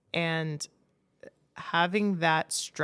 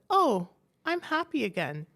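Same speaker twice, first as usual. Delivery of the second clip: a hint of a higher, sing-song mock happiness; mock cheerful; 7 semitones higher than usual. Husband mocking the hope of waking up happy, mock cheerful. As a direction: toss it off like a fake cheery wake-up. Husband mocks magical recovery.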